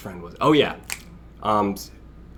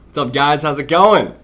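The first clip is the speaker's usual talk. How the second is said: loud, upbeat hello to the chat; excited greeting; 4 semitones higher, 7 dB louder than usual. Real, not acted. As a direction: greet everyone with a burst of energy.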